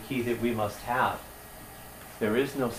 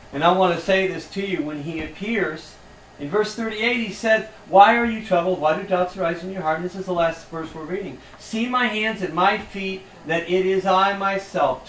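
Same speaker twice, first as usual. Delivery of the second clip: fervent preaching; 5 semitones higher, 5 dB louder than usual. Acted. Fervent scripture reading.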